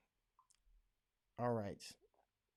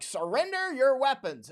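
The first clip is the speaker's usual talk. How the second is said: much higher, snooty mock order; mocking demand; 11 semitones higher, 4 dB louder than usual. Mocking British and Germans lecturing Americans, snooty high. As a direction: lampoon a preachy foreigner. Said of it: "feel compelled to be like".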